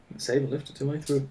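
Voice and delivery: ordinary talk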